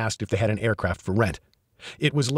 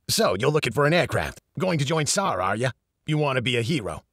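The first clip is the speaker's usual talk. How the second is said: put-on brash New York accent; a fast-talking New York accent, lightly voiced; 3 semitones higher than usual. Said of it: "Eddie spoke with a strong New York accent".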